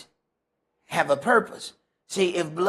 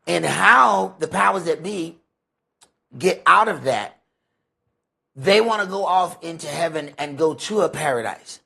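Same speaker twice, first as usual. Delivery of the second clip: ordinary talk, nothing marked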